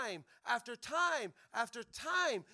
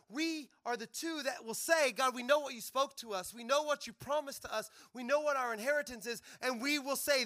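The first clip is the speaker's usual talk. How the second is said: rally speech; 4 dB louder than usual. Acted. Rally of pioneers declaring.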